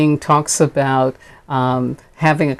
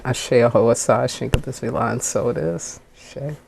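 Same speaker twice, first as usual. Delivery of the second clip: prayer close; 3 semitones lower, 5 dB quieter than usual. Real, not acted. Minister sealing the prayer in several tongues, fading softly. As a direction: lower and softer, fading away.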